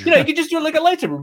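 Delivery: high, fast giddy nerd voice; squeaky rushed fanboy impression; imitating an eager dork. Friend mocking Kirk's lightsaber tip, high and fast. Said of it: "and he's like".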